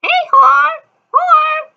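shrill high parrot catcall; loud high bird-voiced heckle; parrot squawk.

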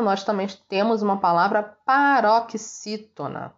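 ordinary talk, nothing marked

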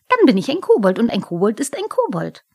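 quick aggrieved comeback; indignant kobold. A narrator's reading. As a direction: argue back hotly as a little kobold.

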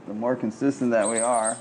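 ordinary delivery

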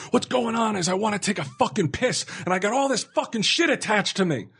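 high-pitched exasperated rant; retold screaming. Patient telling off the orderly, high mock screaming. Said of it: "I had no idea why I was screaming".

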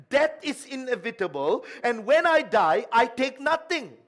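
loud rising preacher's build, each line hammered; fervent pulpit crescendo; preaching at full force. Acted.

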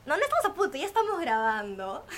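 excited correction. Real, not acted. Correcting himself mid-intro.

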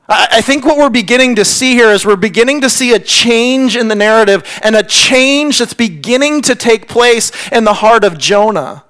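ordinary talk, nothing marked

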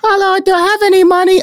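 very high squeaky attempt at an Italian accent; a bad Italian accent, kids begging for money. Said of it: "I can't do an Italian accent".

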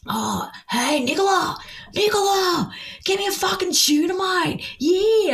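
high-pitched mock Aussie heckling; hammed-up high holler, drawn-out name; rowdy Aussies. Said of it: "seventy-two other Aussies there beside them. And they're like".

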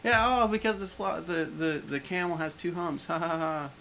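high, put-on movie character voice; mock interrupting voice with a fake laugh; imitated sentence-finisher. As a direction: jump in to finish the joke, high-pitched. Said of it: "keeps trying to finish a sentence".

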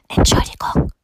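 hushed, voiceless apology; giant's whisper. A narrator's reading. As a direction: whisper it shyly through the window. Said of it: "flüsterte der Riese".

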